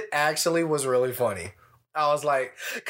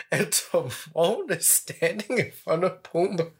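ordinary delivery

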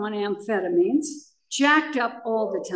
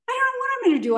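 much higher, wailing repeat at normal volume; pleading higher the second time to Tom; hysterical repeat; 13 semitones higher, much faster than usual. Retold or imitated. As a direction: voice flies up in retold panic. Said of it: "I was completely hysterical and lost".

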